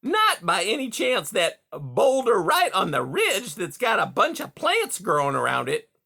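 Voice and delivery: high, eager probing; eager beaver. A narrator's reading. Beaver fishing for Rye's home, high and eager. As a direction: hide your excitement badly. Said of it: "heart fluttered".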